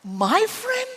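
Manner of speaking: high, rising question; awed disbelief. Acted. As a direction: ask it slowly in wonder. Acts awed disbelief before God.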